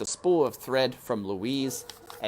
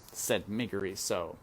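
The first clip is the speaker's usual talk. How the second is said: ordinary delivery, nothing marked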